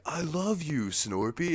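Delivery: high, hammed-up declaration; Chandlo in love. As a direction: play a mock-romantic confession. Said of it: "he's like".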